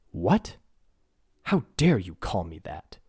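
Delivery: haughty anger at the insult; offended threat. Acted. Giant princess threatening the little human, indignant.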